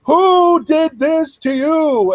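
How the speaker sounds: high, slow, drawn-out shout; imitating Mac's outburst. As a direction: bellow each word in mock outrage. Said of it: "and Mac just says".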